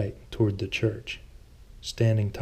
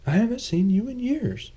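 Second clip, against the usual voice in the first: Thomas; 13 semitones higher than usual. A narrator's reading. Thomas greets an old friend.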